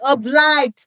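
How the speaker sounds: "Obliged" belted out high and loud; emphatic self-correction. Real, not acted. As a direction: blurt out "Obliged" with big emphasis. Loudly repeats the corrected word.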